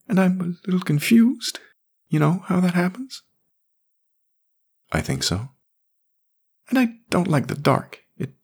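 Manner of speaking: put-on high, small boy voice; scared little boy. A narrator's reading. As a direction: admit it like a frightened child. Jamie confesses.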